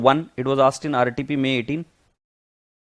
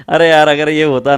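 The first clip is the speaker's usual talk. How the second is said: loud, quick words through laughter; amused and still laughing, rushed; 8 dB louder than usual. Real, not acted. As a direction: talk while coming out of a big laugh. Still amused by the student's test.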